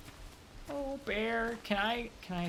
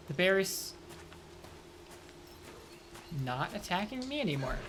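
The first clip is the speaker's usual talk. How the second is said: ordinary talk, nothing marked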